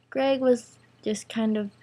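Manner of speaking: plain reading voice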